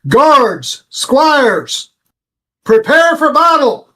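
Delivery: rally the guards to arms; player's character calls the guards out; commanding yell. Acted. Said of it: "open the door to the office and yell".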